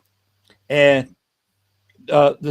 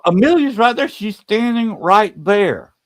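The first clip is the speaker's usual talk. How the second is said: higher-pitched quote, slowing on the last words; insistent sighting; 7 semitones higher than usual. Retold or imitated. Woman telling her companions she can see Amelia's ghost, insistent. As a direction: retell an urgent sighting, voice lifted high.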